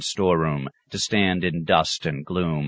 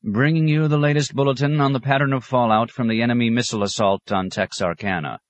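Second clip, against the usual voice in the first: higher-pitched, brisk bulletin voice; radio announcer; 6 semitones higher, 3 dB louder than usual. A narrator's reading. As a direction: announce an urgent wartime bulletin. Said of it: "the announcer was saying".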